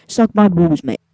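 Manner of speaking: a cheeky, loud jeer; loud taunt. Real, not acted. Racer gloating loudly at Hülkenberg after a pass, loud jeer. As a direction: shout the insult at the rival car.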